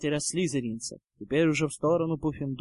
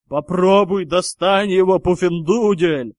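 loud, jeering yell; 3 semitones higher, 10 dB louder than usual. A narrator's reading. Goyle taunting the Hufflepuff from the air, yelling. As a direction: holler the taunt, drawing out the last word. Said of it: "заорал мистер Гойл".

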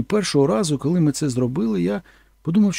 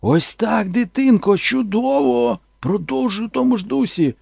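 high, louder, drawn-out praise; excited director shouting; 9 semitones higher, 4 dB louder, slower than usual. Retold or imitated. Director shouting encouragement from the set, high excited. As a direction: cheer her on from afar. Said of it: "кричав звідти".

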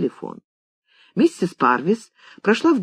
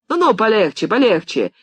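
impatient, slightly raised cry; impatient shout; 5 semitones higher than usual. A narrator's reading. Writer tells George to ease off, impatiently. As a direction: cut him off with an irritated outburst. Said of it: "нетерпеливо закричал тот".

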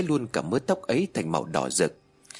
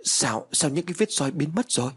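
higher, startled exclamation; startled outcry; 5 semitones higher than usual. A narrator's reading. Mrs. Mỹ startled to see the whip marks vanish, higher shocked outcry. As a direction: gasp it out in shock, voice pitched up. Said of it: "Bà Mỹ liền thốt lên".

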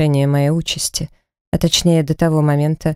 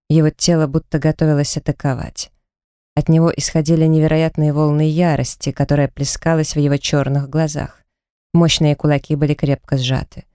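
ordinary delivery

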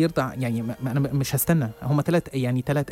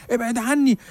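much higher panicked plea of ابعد عني; terrified pleading; 9 semitones higher, slower than usual. Retold or imitated. Old man recoils from the journalist, high frightened plea. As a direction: shrink back, begging in fear. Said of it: "بص لي برعب".

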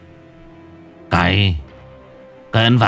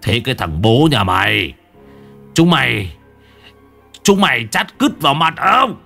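put-on furious old man; 4 semitones higher than usual. Master catching his servant with his wife, furious yell. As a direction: roar at them, building. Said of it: "Lão Cai díu cái ba toang".

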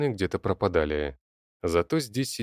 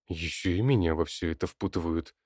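ordinary delivery, nothing marked

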